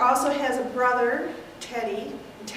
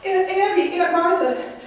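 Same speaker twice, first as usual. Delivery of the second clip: higher, louder, panicked cry; shocked alarm; 4 semitones higher, 5 dB louder than usual. Acted. Mortimer tells the aunts of the body, panicked and louder. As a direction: blurt out the horror, half laughing. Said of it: "and say".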